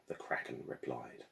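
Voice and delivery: ordinary delivery, nothing marked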